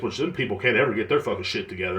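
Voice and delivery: ordinary talk, nothing marked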